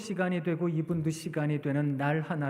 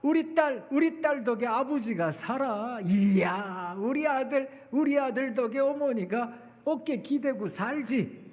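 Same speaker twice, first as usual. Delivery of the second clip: delighted parent; 5 semitones higher than usual. Acted. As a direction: gush over your child like a proud parent. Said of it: "그 부모님이". (감탄하며 자랑스러운 부모 목소리)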